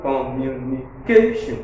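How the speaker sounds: "communication" with its "ca" syllable punched out; stressed syllable. Shown as a demonstration. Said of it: "When you stress a syllable".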